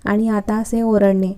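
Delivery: ordinary delivery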